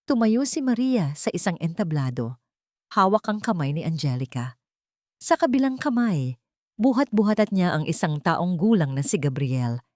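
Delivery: ordinary delivery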